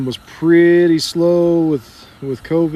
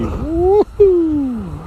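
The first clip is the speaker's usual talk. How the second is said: excited whoop; 12 semitones higher, 3 dB louder than usual. Real, not acted. Dog gets excited.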